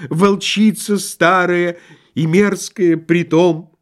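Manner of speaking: high, loud chanted lament in verse; drawling whine. A narrator's reading. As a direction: intone it like tragic iambic verse, wailing. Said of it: "в том же тягучем тоне".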